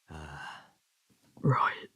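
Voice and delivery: barely voiced ah, right; whispered reply. Fighter answering his rival, quiet whisper. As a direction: breathe it, almost silent.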